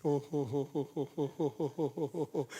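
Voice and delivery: low, soft "ho ho ho" chuckle; a low amused chuckle at the congregation. Real, not acted.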